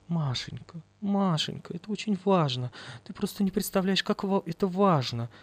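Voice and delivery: soft urgent coaxing. Acted.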